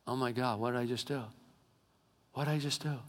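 quietly appalled at himself, only lightly acted; retold stunned horror. Brother realizing what he just did, retold in hushed shock. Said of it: "he lost it going".

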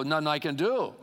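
usual speaking voice

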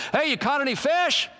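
high-pitched call across distance. Retold or imitated. Jesus calls from shore to fishermen, calling out. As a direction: call out with a rising question. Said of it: "he yells out at them".

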